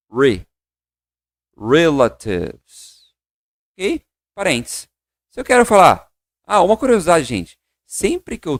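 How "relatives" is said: Relatives drawn out very slowly, re-la-tives; slow pronunciation demo. Shown as a demonstration. Teacher asks class to repeat Relatives, very slow model. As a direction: stretch Relatives syllable by syllable. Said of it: "repete comigo".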